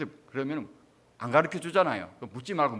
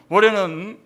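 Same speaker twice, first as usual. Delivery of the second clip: put-on loud, higher-pitched Gyeongsang-accented plea; Gyeongsang dialect; 6 semitones higher, 12 dB louder, slower than usual. Relative pleading with the dead at ancestral rites, loud Gyeongsang dialect. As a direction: imitate a relative praying in Gyeongsang dialect, loudly. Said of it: "경상도 사투리로 그래 하는 거예요".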